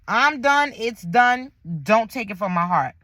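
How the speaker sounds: usual speaking voice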